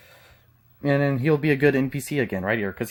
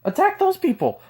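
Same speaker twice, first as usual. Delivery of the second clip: mocking voice; 13 semitones higher than usual. Mocking imitation of opponents.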